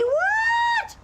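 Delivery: very high, loud shocked shout; shocked what. Real, not acted. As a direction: yelp it in total disbelief. Reacting to chat message.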